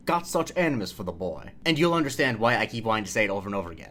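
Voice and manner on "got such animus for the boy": Deep South accent. Shown as a demonstration. Inviting listeners to say the phrase, broad Deep South accent. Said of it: "Just take a moment and say".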